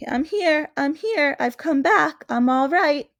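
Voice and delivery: put-on little girl announcing she's safe; Lucy calling out. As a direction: call out breathlessly that you're back.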